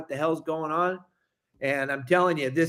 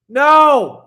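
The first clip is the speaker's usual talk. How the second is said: loud no; 7 semitones higher, 13 dB louder than usual. Real, not acted. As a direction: burst out with a high, loud No. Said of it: "loud retort".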